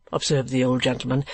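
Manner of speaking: usual speaking voice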